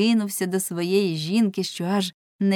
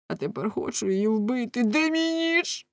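frantic threat; 5 semitones higher than usual. A narrator's reading. Husband demanding his knife to kill, frantic. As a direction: rave upward toward the final question.

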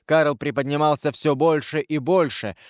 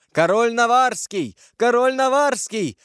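yelling; 10 semitones higher, 3 dB louder than usual. A narrator's reading. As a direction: bawl the alarm across the courtyard. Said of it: "орали часовые". (орать во весь голос)

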